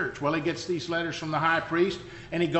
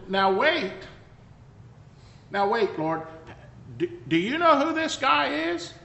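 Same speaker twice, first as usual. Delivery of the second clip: higher, protesting and incredulous; doubtful Ananias; 7 semitones higher than usual. Acted. Ananias questions the Lord about Saul, incredulous and higher. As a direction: push back at the Lord in disbelief. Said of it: "And he said".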